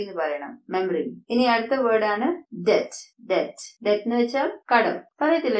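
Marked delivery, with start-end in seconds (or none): 0.7-1.2 "മെമ്മറി" squeezed to MEM-ree; correct pronunciation. Shown as a demonstration. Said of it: "ഇത് correct ആയിട്ട് എങ്ങനെയാണ്". 3.3-3.8 "ഡെറ്റ്" with silent B, DET; correct pronunciation. Shown as a demonstration. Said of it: "ഇത് correct ആയിട്ട് പറയേണ്ടത്".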